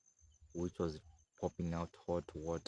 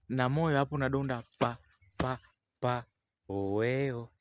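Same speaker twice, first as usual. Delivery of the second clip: heartbeat imitation; 6 semitones higher, 4 dB louder than usual.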